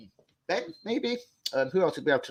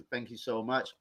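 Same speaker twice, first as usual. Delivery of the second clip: usual speaking voice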